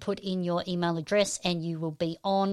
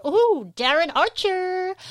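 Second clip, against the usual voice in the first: high, louder, drawn-out greeting; excited shout-out; 11 semitones higher, 6 dB louder, slower than usual. Real, not acted. Livestream host spots a patron joining, excited shout-out. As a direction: call the name out slowly and high.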